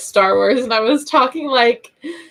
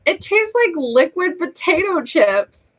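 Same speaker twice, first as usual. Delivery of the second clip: mom's line in a higher put-on voice; quoting mom; 7 semitones higher than usual. Vlogger quoting her mom selling salt water gargle, lightly voiced higher. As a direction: play mom coaxing the kids, a touch higher. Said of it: "And she said".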